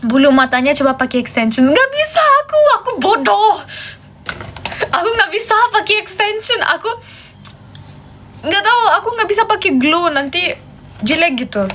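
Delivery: ordinary delivery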